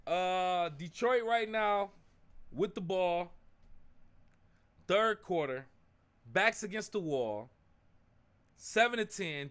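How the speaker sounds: mock sportscaster calling the drive; play-by-play. Streamer calling his team's drive like a broadcaster. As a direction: call the game like a broadcaster.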